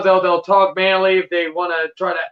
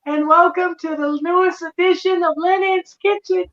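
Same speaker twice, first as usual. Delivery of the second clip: high, chirpy preschool host voice; Lennon impression; 11 semitones higher than usual. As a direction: mimic a sugary kids' show host. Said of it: "it's creepy how I can mimic it, that voice good".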